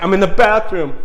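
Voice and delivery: high, loud distraught wail; retold tearful cry; imitated sobbing. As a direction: imitate someone upset on the phone. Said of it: "she's sobbing uncontrollably".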